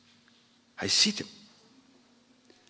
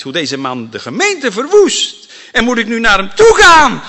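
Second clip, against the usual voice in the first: louder, higher, rising disbelief; incredulous protest; 11 semitones higher, 13 dB louder than usual. Acted. Ananias protesting to God. (ongelovig protest, steeds luider)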